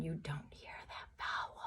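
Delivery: secretive whisper. Acted. Teacher whispers to her class about the silent vowel.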